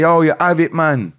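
high, loud Jamaican approval; imitating approving Jamaicans. Jamaicans approving of his accent, hearty loud patois. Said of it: "Or them say".

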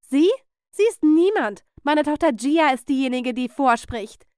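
loud, high, indignant protest; outraged objection. Acted. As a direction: object forcefully on your daughter's behalf. Outraged aunt objects to director.

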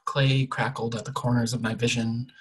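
ordinary delivery

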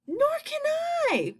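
thin, high storybook wailing. A narrator's reading. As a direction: wail it in helpless despair. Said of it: "wailed the glowworm".

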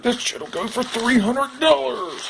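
high, put-on stuffed-tiger voice; Tiger Blanket voice. Stuffed tiger plotting to resell, high toy voice. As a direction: squeak it out like a scheming toy. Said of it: "He's like".

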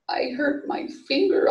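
high, mildly wailed complaint; narrator's understated wail of a hurt child; childish wail. A narrator's reading. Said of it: "I wailed into the phone".

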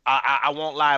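usual speaking voice

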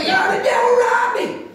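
high shouted self-pity; hammed-up victim cry; mock complaining shout. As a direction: wail that the devil robbed you. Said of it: "we all wanna".

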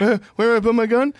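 hurried, high mock panic; panicking agent. Agent who can't holster her weapon, hammed-up panic. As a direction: blurt it out like a flustered rookie. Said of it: "you're panicking".

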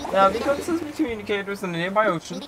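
bad Irish pirate brogue, briskly instructing; awful Irish accent. Castaway pirate sending the player out searching, awful Irish accent. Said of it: "very awful accent".

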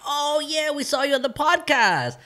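very high, loud mock-fan voice; imitated excited recognition. Reunion classmates recognizing the podcast guest, mimicked excitedly. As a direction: squeal it like a classmate suddenly recognizing someone.

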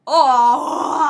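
long, very loud falling wail; loud wailing cry. Real, not acted. Player as the level starts moving, long loud wail. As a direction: howl it out, then break up. Sudden failure in game.

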